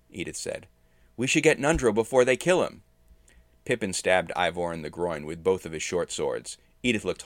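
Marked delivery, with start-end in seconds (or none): 1.2-2.8 higher, louder plea; raised-voice appeal; urgent urging. A narrator's reading. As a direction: press them urgently before it's too late. Captive urging the attackers.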